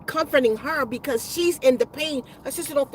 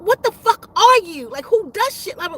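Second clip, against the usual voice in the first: louder, higher incredulous demand; shocked challenge; 5 semitones higher, 5 dB louder than usual. Retold or imitated. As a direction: act out someone taken aback and demanding answers. Retelling someone's shocked challenge.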